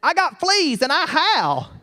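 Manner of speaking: put-on slow, high earnest boyish voice; Bill. Bill explaining to the cowboy why he's a coyote, slow and high. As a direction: say it like a wild boy proud of his fleas. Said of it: "He said".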